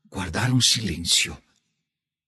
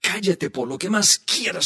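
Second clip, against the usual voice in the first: voz aguda de niño rogando; súplica infantil; 9 semitones higher than usual. A narrator's reading. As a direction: pide silencio con urgencia. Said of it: "suplicó Pepe". (niño ruega silencio, voz aguda)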